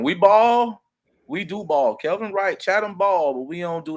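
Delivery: hyped call-out for his city; excited boast. Real, not acted. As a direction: pump up the hometown pride.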